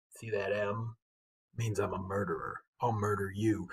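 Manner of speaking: low, soft, menacing threat to a stranger; menacing murderer. Retold or imitated. Bully in a bar threatening a stranger, low and quiet menace. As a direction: lean in and growl the threat quietly. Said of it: "he'd be like".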